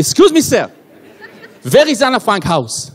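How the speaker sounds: put-on slow German-accented English. German tourists asking the way to a museum, German accent. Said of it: "פתאום ניגשו לשני תיירים גרמנים".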